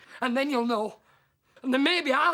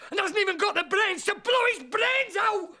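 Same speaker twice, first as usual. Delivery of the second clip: bitter shout; 8 semitones higher than usual. Acted. Ranting husband cursing himself to his friend, shouting. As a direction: spit the insult at yourself full voice. Self-loathing rant.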